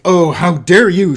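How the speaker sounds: loud, slow, hammed-up indignation; mock groused. Shown as a demonstration. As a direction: overplay an offended huff. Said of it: "she groused".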